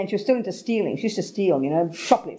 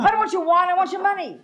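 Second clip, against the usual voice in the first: high, insistent mugger reply; retold robber rejecting the wine; robber's refusal; 9 semitones higher than usual. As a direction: brush off the wine, demand cash. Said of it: "robber replies".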